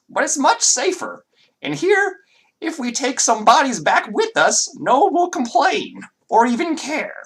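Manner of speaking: put-on very high, loud, accented man's voice; a creepy doctor lecturing his assistant in a high voice; high-pitched masculine voice. Doctor scolding his assistant, high and loud. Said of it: "And then there's a response".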